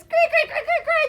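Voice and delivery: high, loud cackling "cray, cray"; rooster crowing. Acted. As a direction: crow it like an angry rooster. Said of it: "he says".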